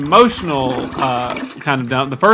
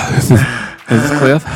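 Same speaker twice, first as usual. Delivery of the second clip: sighing, winded intro; mock out of breath; 4 semitones lower, 4 dB louder than usual. Mocking their own show's intro, wheezing and sighing. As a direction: puff it out between sighs. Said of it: "our show sounds like".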